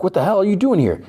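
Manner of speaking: high-pitched acted disbelief; retold startled outburst. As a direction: act out a shocked captive, voice jumping up. Cuffed man startled by his captor.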